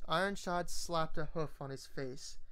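ordinary delivery, nothing marked

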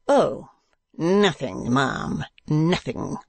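rough-edged, bitter dismissal. A narrator's reading. Visitor dismissing the couple's quarrel, rough and bitter. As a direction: brush it off with sour roughness. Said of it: "in the rough and bitter voice".

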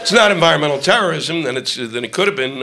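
ordinary talk, nothing marked

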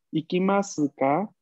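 "Ikimasu ka?" climbing in pitch on "ka"; rising tune. Shown as a demonstration. Teacher isolates "Ikimasu ka?" for students, rising. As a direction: raise "Ikimasu ka?" at the end. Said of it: "el tono ascendiente".

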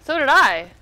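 high, loud laughing. Real, not acted. Laughing after airstrike.

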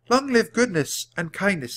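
high, lightly voiced girl's cheer; mild rallying shout. A narrator's reading. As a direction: proclaim it high, without really shouting. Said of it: "Jack and Annie shouted their words".

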